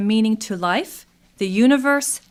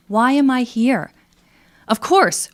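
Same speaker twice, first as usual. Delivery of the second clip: ordinary talk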